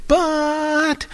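very high, stretched-out objection; nitpicking objection. Acted. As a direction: hold the word high and long. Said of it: "and they go".

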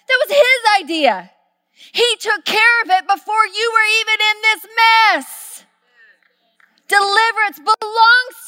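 a rising sermon climax; full-force preaching. Real, not acted. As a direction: proclaim it to the whole congregation.

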